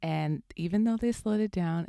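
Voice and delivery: usual speaking voice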